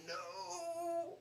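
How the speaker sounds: high, long drawn-out "no"; hammed-up cry of grief, held back in volume; mock anguished wail. Viewer reacting to the tragic ending, mock anguished wail. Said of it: "just so emotional and good".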